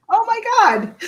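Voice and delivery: high, loud gasp of disbelief; real astonishment, pitch shooting up; shocked. Real, not acted. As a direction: react to an outrageous confession. Reacts to shotgun confession.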